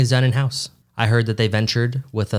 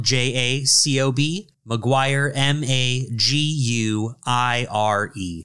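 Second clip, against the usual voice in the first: slow letter-by-letter spelling; much slower than usual. Shown as a demonstration. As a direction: spell the name slowly, each letter distinct. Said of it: "Jacob is spelled".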